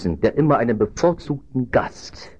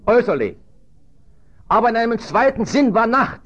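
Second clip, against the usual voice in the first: high, ringing proclamation from the pulpit; full-force preaching; 12 semitones higher, 5 dB louder than usual. Real, not acted. Preacher tells congregation how night fell on Judas, full force. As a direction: proclaim it urgently in separate, forceful phrases.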